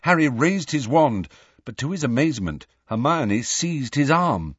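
ordinary delivery

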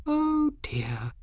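high, soft, breathed alarm. A narrator's reading. Girl caught by the wrist, stiffening, breathed softly. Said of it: "and she breathed".

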